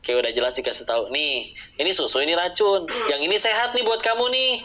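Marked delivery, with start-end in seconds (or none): 1.1-4.5 a friend insistently showing which glass is milk and which is poison; emphatic explaining. Acted. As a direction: spell it out like warning a child. Acting someone explaining milk and poison.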